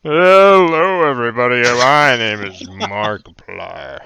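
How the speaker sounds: loud, slow YouTuber intro voice; Markiplier. Retold or imitated. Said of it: "you can do the Markiplier voice".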